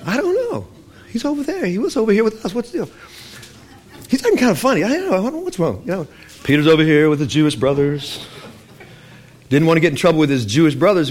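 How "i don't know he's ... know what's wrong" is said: gossipy bewildered believers; puzzled Gentiles. Acted. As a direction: voice the confused onlookers up high. Gentiles puzzled about Peter.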